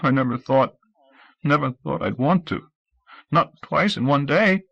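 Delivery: a touch of sobbing, slowing at the end. Acted. Scout confesses to Audrey while sobbing, mild. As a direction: get the words out through sobs. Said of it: "He was sobbing now".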